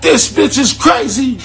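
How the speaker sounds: high, loud stoned outburst; high-pitched shout at the crazy screen; amazed shout. Retold or imitated. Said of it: "I'm like".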